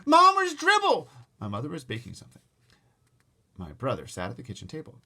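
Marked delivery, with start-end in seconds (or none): 0.1-1.1 high, loud, slow yell for mom; holler. Acted. Boy calling to his mother in the kitchen, loud holler. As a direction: holler across the apartment.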